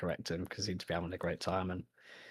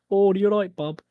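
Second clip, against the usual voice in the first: exaggerated Birmingham accent, higher and louder; Brummy accent; 13 semitones higher, 13 dB louder than usual. Shown as a demonstration. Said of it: "a very Brummy accent".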